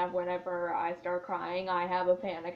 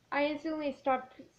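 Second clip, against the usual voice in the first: ordinary talk, nothing marked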